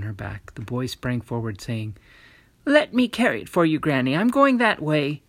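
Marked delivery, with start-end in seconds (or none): 2.6-5.2 put-on high, loud and eager young boy; boy eagerly offering to carry granny's sack; eager boy's voice. A narrator's reading. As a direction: offer help brightly like a boy making amends.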